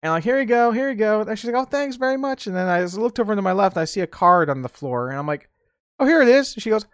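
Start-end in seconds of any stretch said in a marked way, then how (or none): 0.2-1.2 cheerful handing over. Retold or imitated. 1.6-2.5 grandma thanking. Retold or imitated. 6.0-6.5 surprised find. Retold or imitated. Finding the card.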